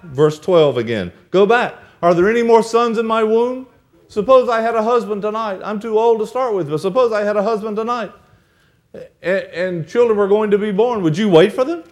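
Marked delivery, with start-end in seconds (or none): 1.3-11.8 higher, insistent mother-in-law urging; pleading Naomi. Acted. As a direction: push them away with earnest pleading. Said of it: "she said".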